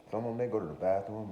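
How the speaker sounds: ordinary talk, nothing marked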